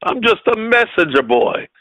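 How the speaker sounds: ordinary talk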